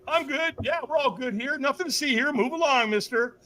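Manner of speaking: high-pitched, unconvincing reassurance; fake I'm fine. Speaker waves off the stranger, falsely fine. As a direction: brush them off in a high, overly okay voice. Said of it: "when we're not okay, what do we say?".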